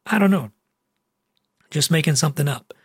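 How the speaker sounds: ordinary delivery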